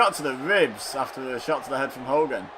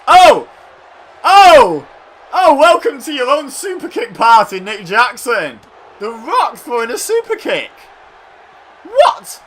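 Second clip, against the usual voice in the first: excited shouting; 9 semitones higher, 13 dB louder than usual. Real, not acted. Big surprise move in match.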